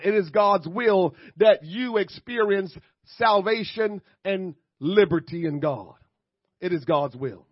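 rhythmic pulpit proclamation; preaching refrain. Real, not acted. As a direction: proclaim each repeated line to the congregation.

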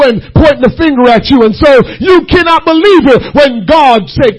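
loud, ringing sermon build; full-force preaching. Acted. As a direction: preach it out to the congregation. Preaching rising.

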